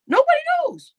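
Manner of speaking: sudden falsetto, sarcastic; high mocking falsetto; mock high squeal. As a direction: squeak it out in disbelief.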